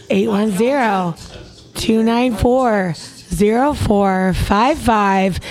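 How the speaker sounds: slow, deliberate phone number; slow number read. Shown as a demonstration. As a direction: read each digit out slowly. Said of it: "And I practice that".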